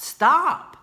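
high loud scolding stop; shrill stop. Retold or imitated. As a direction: shout stop at them. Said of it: "I wanna get in there and be like".

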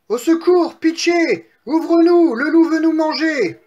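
very high, loud shouts for rescue; panicked piglets. Acted. Two piglets beg brother to open up, panicked. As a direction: scream to be let in.

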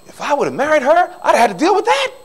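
relieved. Retold or imitated. Relieved exclamation, high pitch.

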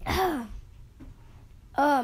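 ordinary delivery

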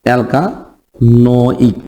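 "অ্যালকানোইক" drawn out very slowly in pieces; stretched, broken-up demonstration of "অ্যালকানোইক"; syllable by syllable. Shown as a demonstration. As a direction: teach "অ্যালকানোইক" slowly, breaking it into al-ka, no-ik. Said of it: "তো আমরা নাম বলবো হচ্ছে".

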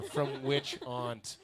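drawn out as if read from a memorized script; deliberate scripted delivery, very slow; slow, rehearsed-sounding line. Retold or imitated. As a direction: say it very slowly and precisely like a rehearsed line. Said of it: "she said it like it was on a script".

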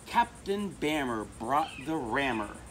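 plain reading voice

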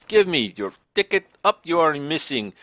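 usual speaking voice

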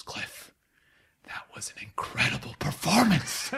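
confidential stage whisper, imitating drama teacher. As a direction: whisper it behind a closed door. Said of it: "she whispers".

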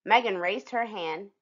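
plain reading voice

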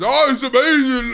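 hammed-up copy of a stranger's praise; mimicking the random man. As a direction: parrot the stranger, overdone. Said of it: "And then he goes".

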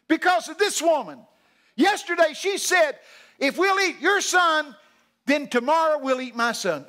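imitating an agitated woman, easing at the end. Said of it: "so agitated".

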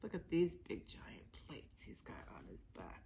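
soft secret whisper; hushed whisper about the plates. Acted. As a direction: lean in and whisper to the children. Confiding aside to kids.

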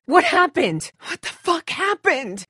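high, shocked demand; jolted by gunshots; alarmed shock. Acted. Survivor rushing in after hearing shots, alarmed shock.

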